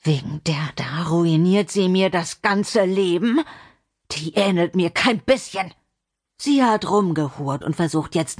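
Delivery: hissing man's rant, partly whispered; bitter, angry man. Acted. Intruder raging about the child ruining his life, seething. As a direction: seethe with contempt through the accusation.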